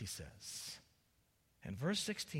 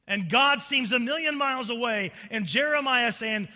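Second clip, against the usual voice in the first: loud, raised preacher's cry; full-force preaching; 7 semitones higher, 14 dB louder than usual. Real, not acted. Preacher laments famine and dying children, at full force. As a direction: thunder it out to the congregation.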